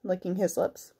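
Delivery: ordinary delivery, nothing marked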